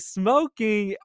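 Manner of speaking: same shocked mom, volume pulled back; toned-down mom voice. Retold or imitated. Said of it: "I'm sorry, y'all".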